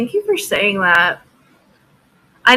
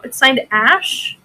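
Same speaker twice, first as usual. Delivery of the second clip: normal talking voice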